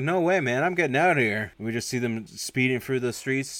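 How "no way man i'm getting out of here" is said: higher, louder acted refusal; mock panicky refusal. Driver chased by police refusing to pull over, mock panicky. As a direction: refuse as if making a getaway.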